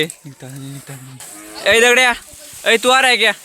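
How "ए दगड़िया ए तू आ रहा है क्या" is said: louder, higher shout to a friend; calling out. Real, not acted. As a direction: call him over loudly. (ज़ोर से पुकारते हुए)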